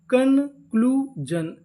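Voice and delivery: Conclusion stretched very slowly, syllable by syllable; drawn-out demo. Shown as a demonstration. Teacher slowly sounding out the whole word for students. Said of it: "तो meaning क्या बनी?".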